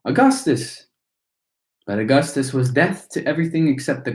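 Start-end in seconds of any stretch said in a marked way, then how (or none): 0.0-0.9 mild calling cry. A narrator's reading. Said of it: "cried Missus Gloop".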